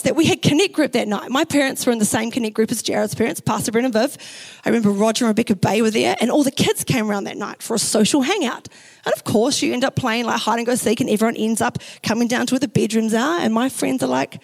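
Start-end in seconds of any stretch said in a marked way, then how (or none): none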